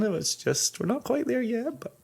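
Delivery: high and soft, sheepish; sheepish quiet voice. Acted. Sheepish admission.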